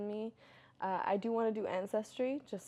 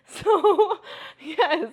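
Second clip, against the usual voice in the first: laughing; 10 semitones higher, 15 dB louder than usual. Real, not acted. Laughing after tattoo story.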